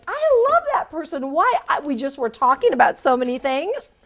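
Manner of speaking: high, loud I love that person; mock enthusiastic recruiter; gushing recruiter. Recruiter raves after a chatty interview, gushing. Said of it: "recruiters will be like".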